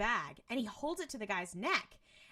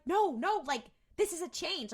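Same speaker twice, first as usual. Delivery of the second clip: high, loud excited insistence; urgently insist, pitched up; retold insistence; 8 semitones higher, 5 dB louder than usual. Sam tells Dean the loop has changed, insistent.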